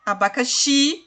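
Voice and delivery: Abacaxi with a long, strong final XI; stress on the last syllable. Shown as a demonstration. Teacher shows the tonic syllable, Abacaxi stressed on final XI. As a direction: land on the XI of Abacaxi. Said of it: "xi é a sílaba tônica".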